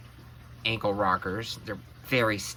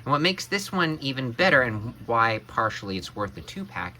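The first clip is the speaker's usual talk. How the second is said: ordinary talk, nothing marked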